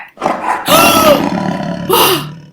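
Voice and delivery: high startled pretend gasp; mock frightened gasp. Teacher pretending to be scared by kids' roar, gasping. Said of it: "thought there was a real lion".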